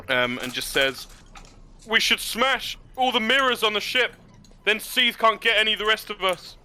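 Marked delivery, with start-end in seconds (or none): 1.9-6.6 higher anxious voice from the crowd; a voice in the crowd calling out, pitched high. Acted. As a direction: shout it up from the back of a crowd. Voice calls out from crowd.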